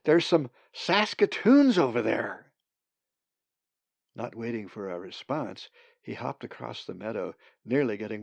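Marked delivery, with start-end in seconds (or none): 0.0-2.5 raised, slightly impatient repeat; loud repeat over the wind; repeating louder. Acted. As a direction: say it again, louder, so she hears you. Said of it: "Brendan repeated".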